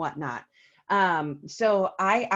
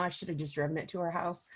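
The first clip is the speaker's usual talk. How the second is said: normal talking voice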